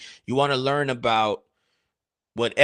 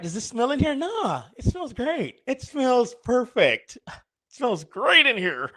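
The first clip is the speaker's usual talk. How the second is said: high mock denial; 9 semitones higher than usual. Acted dialogue about smell.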